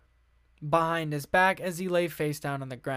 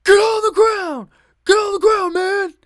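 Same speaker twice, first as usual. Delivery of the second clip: much higher, much louder barked command; police shout; 13 semitones higher, 11 dB louder than usual. Retold or imitated. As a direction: yell it like a cop making an arrest. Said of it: "an officer barked at".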